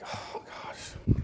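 whispered under the breath; dismayed whisper. Retold or imitated. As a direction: breathe it out, overwhelmed. Dismayed reaction to Jesus remark.

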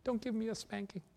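put-on high, soft pleading little-boy voice; mock crying child. A narrator's reading. Younger brother begs Mommy not to spank him, mock crying. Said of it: "the younger brother was crying".

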